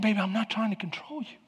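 calm him down softly; gentle wife. Retold or imitated. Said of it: "she finally started saying".

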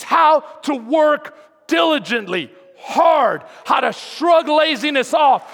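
loud fervent sermon; preaching at full force. Acted.

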